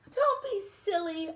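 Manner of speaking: light laughing reassurance; playful dismissal. Acted. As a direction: brush off his worry with a laugh in the voice. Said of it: "Aurora said with a laugh".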